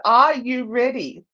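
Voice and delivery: ordinary talk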